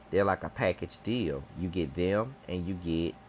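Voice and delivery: ordinary talk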